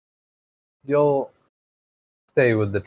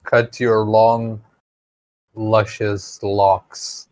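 slow teasing drawl; 3 semitones lower, much slower than usual. Pretend. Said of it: "teasing drawn-out description".

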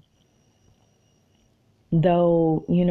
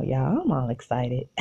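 ordinary talk

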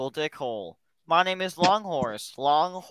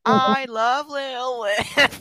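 slow, stretched, higher goofy voice; put-on voice; 6 semitones higher, 5 dB louder, much slower than usual. Teasing friends with a goofy slow voice. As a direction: drag it out in a silly put-on voice. Mock voice chant.

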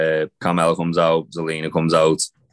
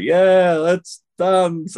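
a much higher, louder cheery imitation; mock Bianca; 11 semitones higher, 3 dB louder, much slower than usual. Mocking Bianca's forced cheer while dancing with Sasha, high and bright. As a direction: ham up her forced eagerness. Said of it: "Bianca was like".

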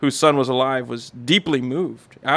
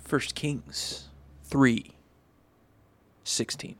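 very slow and weighty, the last word whispered; dramatic slow announcement; 9 dB quieter, much slower than usual. Shown as a demonstration. Reading scripture to the study group, dramatically slow then whispered. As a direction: announce the chapter like a movie trailer, fading to a whisper. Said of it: "I'm gonna dramatize it".

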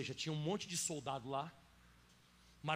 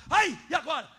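much higher, louder mock panic; mock hysterical cry; 9 semitones higher, 14 dB louder than usual. Pastor mimicking a hysterical worshipper in the dark.

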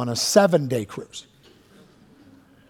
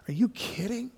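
breathless whispered disbelief; stunned whisper; 6 semitones higher, 9 dB quieter than usual. Acted. Preacher imagining a father told to give up his child, stunned whisper. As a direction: whisper it as if the floor just dropped away.

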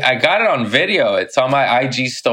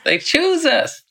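very high, squeaky cat voice; slower than usual. Pretend. Comic replying as the cat, in a high squeak.

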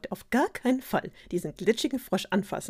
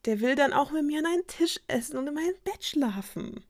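princess's complaint; 9 semitones higher than usual. A narrator's reading. Princess objects to the frog sharing table and bed, complaining. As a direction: complain in a raised story-character pitch.

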